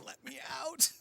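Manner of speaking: high mock-desperate baby voice. Baby crawling for the door, mock-desperate plea. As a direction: start thin and hushed, rise into a mock wail. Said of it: "the baby's just, like, crawling for the door".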